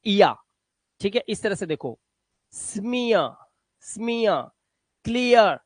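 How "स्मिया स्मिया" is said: स्मिया with a long ee gliding to a soft uh; ia ending. Shown as a demonstration. Teacher models स्मिया for the class, soft ia ending. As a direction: say स्मिया slowly, no r at the end. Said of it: "ईए का उच्चारण आपको इया करना है".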